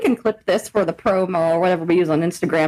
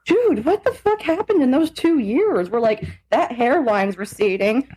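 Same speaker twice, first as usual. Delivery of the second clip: ordinary talk, nothing marked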